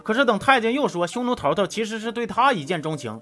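ordinary talk, nothing marked

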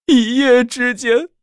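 building to a high, loud, drawn-out wail; anguished lament. Acted. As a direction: lament with mounting hammed-up despair. Acted lament building to punchline.

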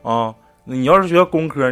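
usual speaking voice

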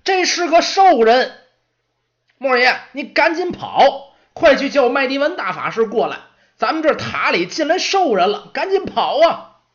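high, loud panicked yelling; alarmed shout. Acted. Young apprentice yelling at Moros to run from the orc, panicked shout. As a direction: scream the alarm at full voice. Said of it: "这边大喊".

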